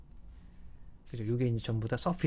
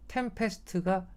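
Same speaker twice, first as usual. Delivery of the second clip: 템페스트가 drawn out slowly, pitched high; stress demonstration; 7 semitones higher, slower than usual. Shown as a demonstration. Lecturer shows students where the stress sits, stress demonstration on 템페스트가. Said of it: "강세가 지금 여러분 여기 있는 거거든요".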